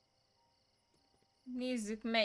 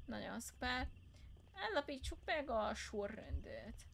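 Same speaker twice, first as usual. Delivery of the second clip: ordinary delivery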